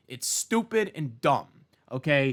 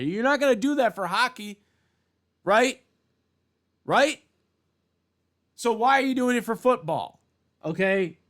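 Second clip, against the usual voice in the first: higher, louder rising demand; indignant challenge; 9 semitones higher, 6 dB louder than usual. Real, not acted. Vlogger demands why football gets flags, indignant. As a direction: challenge them to answer.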